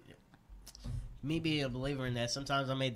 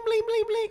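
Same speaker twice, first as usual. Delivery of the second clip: high, loud repeated blings; playful bling sound effect; 6 dB louder than usual. Pretend. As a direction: chime out each bling high. Acts out visualizing riches appearing.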